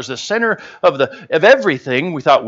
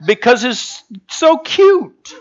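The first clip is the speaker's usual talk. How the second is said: much higher, slow cooing with a laugh; mock gushing; 10 semitones higher, 4 dB louder, slower than usual. Real, not acted. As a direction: gush over the cute rat. Gushing about the rat.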